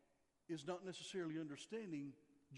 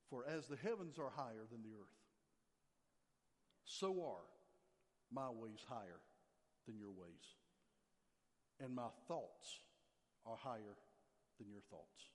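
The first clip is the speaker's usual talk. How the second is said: solemn scripture reading; 7 semitones lower than usual. Acted. Pastor reads Isaiah to his congregation, low and solemn.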